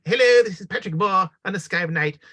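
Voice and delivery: high, loud, animated eccentric presenter; bouncy old British TV astronomer voice; Patrick Moore impression. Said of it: "He had this very, very animated way of speaking".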